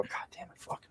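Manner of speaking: under-the-breath curse; whispered frustrated swearing; a quiet self-directed curse; muttered curse. Retold or imitated. Quoting own frustrated curse.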